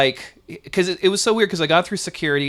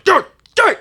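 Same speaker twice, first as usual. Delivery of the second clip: much higher, louder call; calling the dog angrily; 8 dB louder than usual. Retold or imitated. Officer scolding the sniffer dog, loud sharp call. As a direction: yell the dog's name sharply. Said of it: "getting mad at the dog".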